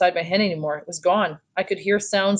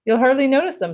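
higher, lightly forced cheer; trying to be cheerful; 7 semitones higher than usual. A narrator's reading. Mom reassuring her child about hearing aids, mildly cheerful. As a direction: gently talk it up. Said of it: "trying to be cheerful".

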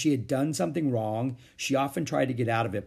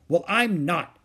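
higher, louder snapped retort; snapping; 6 semitones higher, 5 dB louder, slower than usual. A narrator's reading. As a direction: snap it back at her. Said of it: "snapped Beezus".

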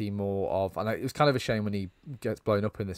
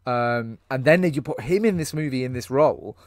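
ordinary talk, nothing marked

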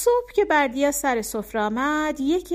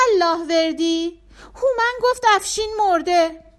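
put-on high, tearful little boy's voice; a small boy near tears with bad news; child crying; 8 semitones higher, 7 dB louder than usual. A narrator's reading. Bardia telling Allahverdi that Afshin is dead, tearful and high.

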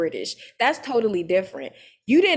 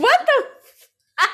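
laughing disbelief; 14 semitones higher, much slower than usual. Real, not acted. Hearing Jackie's reply, she gasps in laughing disbelief. Her own stunned reaction, laughing.

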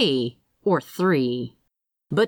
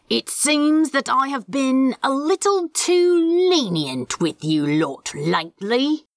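raised to carry; 6 semitones higher than usual. A narrator's reading. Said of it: "raising his voice to carry".

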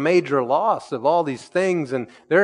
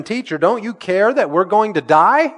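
mock panic, higher and louder; panicked disciples; 6 semitones higher, 5 dB louder than usual. Pastor voicing the terrified disciples, panicked. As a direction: cry out like men about to drown.

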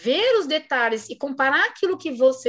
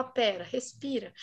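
much softer, slow pera, respira; calm a student in a hushed voice; soft reassurance; 3 semitones lower, 10 dB quieter, slower than usual. Retold or imitated. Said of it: "você fala".

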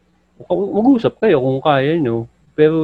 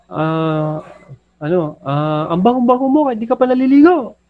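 mock shouted praise; 9 semitones higher than usual. Husband yelling a backhanded compliment at his wife, high mock shout. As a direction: yell a sweet line with a sly jab. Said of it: "O kaya".

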